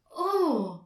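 loud, high exclamation of surprise; astonished. A narrator's reading. Herr Marconi hearing the girl's name, astonished. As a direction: cry out in wonder. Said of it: "rief Herr Marconi verwundert".

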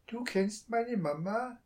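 put-on a child's wide-eyed wonder; astonished child. A narrator's reading. Child asks the thief, astonished. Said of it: "fragte das Kind erstaunt".

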